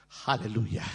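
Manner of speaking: usual speaking voice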